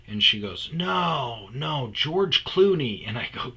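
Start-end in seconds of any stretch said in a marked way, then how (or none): 0.7-3.1 high, drawn-out correction; slow, raised-pitch voice of the woman; imitated insistent woman. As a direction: mimic her insisting, slowly and higher. Woman corrects his guess, retold.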